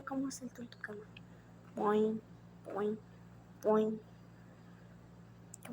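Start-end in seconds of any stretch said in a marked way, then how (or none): 1.7-4.0 springy mock bounce noise; playful cartoon bounce sounds; boing sound effect. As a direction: make each boing bounce like springs on a bed. Doll jumping on the bed.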